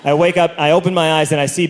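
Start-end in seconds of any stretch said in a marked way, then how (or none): none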